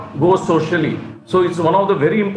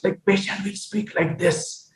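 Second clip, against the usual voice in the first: airy, weak breathy voice; 2 semitones higher, 7 dB quieter than usual. Shown as a demonstration. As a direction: let air leak through every word. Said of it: "You will have a breathy voice".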